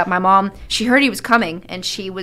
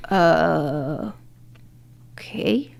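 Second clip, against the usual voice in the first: long hesitant uh, then soft whispered okay; imitated reluctant agreement; 3 semitones lower, 4 dB quieter, much slower than usual. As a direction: act out an unsure, hushed yes. Dad hesitantly accepts date.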